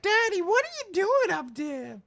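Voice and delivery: put-on a kid asking daddy what he's up to; child's voice. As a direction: play the puzzled little kid. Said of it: "two would look at me like".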